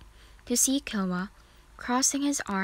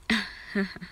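chuckle. A narrator's reading. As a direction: let out a brief teasing chuckle. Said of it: "he chuckled".

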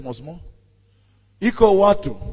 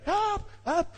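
thin, much higher cry, held back in volume; crying for help; 7 dB quieter than usual. A narrator's reading. Man alone in the pit after helper leaves, high weak cry. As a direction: call up weakly from below. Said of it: "continues to cry".

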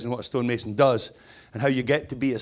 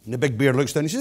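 usual speaking voice

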